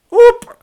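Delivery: sudden loud, very high squeak. Acted. Husband trying to break the tension, comic high squeak. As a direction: squeak out a startled little yelp. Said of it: "squeaked Sean".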